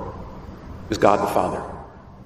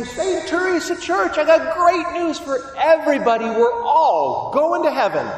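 much higher joyful proclamation; angel herald; 12 semitones higher than usual. Retold or imitated. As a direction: announce it like a beaming angel. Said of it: "an angel ... and say".

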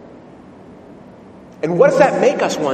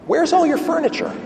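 high-pitched, astonished question; puzzled visitor; 12 semitones higher than usual. Acted. Wealthy businessman amazed at rabbi's bare house, puzzled question. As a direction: ask it high, baffled by what you see. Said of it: "Turns to the rabbi and he says".

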